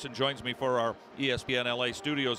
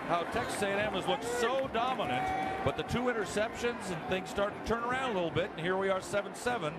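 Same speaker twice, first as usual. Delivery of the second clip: excited, high touchdown call; live play-by-play; 8 semitones higher than usual. Real, not acted. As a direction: call the score as it happens.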